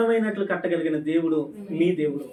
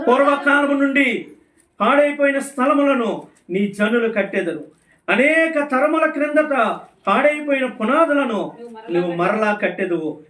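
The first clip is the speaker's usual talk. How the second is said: raised, declamatory Bible reading; scripture proclamation; 4 semitones higher, 3 dB louder than usual. Real, not acted. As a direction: ring out each phrase, stretching the endings. (లేఖనాన్ని గట్టిగా ప్రకటిస్తూ చదవడం)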